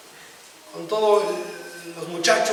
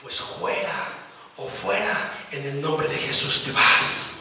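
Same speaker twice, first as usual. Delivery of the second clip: humble retort; 6 semitones lower, 5 dB quieter, slower than usual. Retold or imitated. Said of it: "Y este hombre le dijo".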